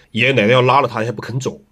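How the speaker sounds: ordinary talk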